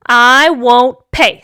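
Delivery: slow, loud emphatic refusal; firm refusal. Acted. Father arguing with the cashier, stubborn. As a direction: dig in, word by word.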